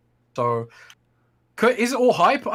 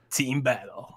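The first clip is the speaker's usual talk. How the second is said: nasal game announcer call; Halo announcer voice; much slower than usual. Shown as a demonstration. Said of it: "talk like the Halo announcer".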